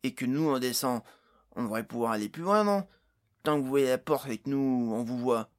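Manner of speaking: loud, put-on character voice; Jason proposing a plan. A narrator's reading. Jason explaining his test to the companions, eagerly suggesting. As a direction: pitch the idea loudly, in character.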